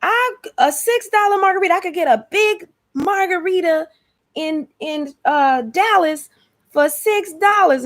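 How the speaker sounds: very high-pitched, drawn-out mock voice; imitating Texan YouTuber. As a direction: imitate an over-the-top young Texan woman complaining. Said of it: "So she was like".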